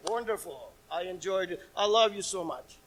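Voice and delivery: soft, low sarcastic praise; mock cheer. Preacher mocking a cheering bystander, quiet sarcasm. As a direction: sneer the compliments sarcastically.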